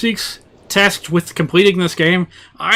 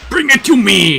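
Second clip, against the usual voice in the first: lightly voiced higher, louder villain; 7 semitones higher, 5 dB louder than usual. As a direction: echo the game villain with a hint of menace. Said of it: "He sounds like a villain".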